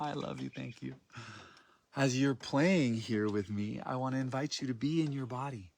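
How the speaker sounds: ordinary delivery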